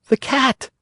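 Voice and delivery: high, small puppet voice; high boy voice. A narrator's reading. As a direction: answer meekly and high. Pinocchio answering.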